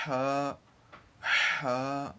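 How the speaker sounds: ہا said short and light, a clear breathy h; fine thin letter. Shown as a demonstration. Teacher demonstrating a fine ہا for students, crisp and short. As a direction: give ہا a fine, delicate h sound. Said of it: "ہا کو بالکل ہم نے باریکی سے ادا کرنا".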